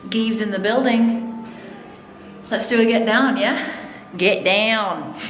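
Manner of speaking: slow, drawn-out hype announcement; showman announcer. Pretend. Performer hyping her entrance to viewers, announcer style. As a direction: announce yourself like a ring host.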